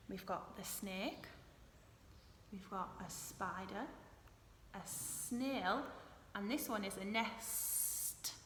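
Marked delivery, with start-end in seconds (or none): none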